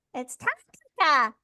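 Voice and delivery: high, slow, cutesy cartoon girl; super high cutesy voice. Shown as a demonstration. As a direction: squeak it out sweet and tiny. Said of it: "let's see if I can do it".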